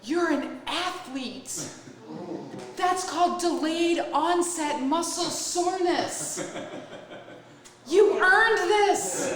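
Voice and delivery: high, slow, drawn-out proud announcement; tearful pride, retold. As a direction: proclaim it through mock happy tears. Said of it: "big tears welling up in my eyes".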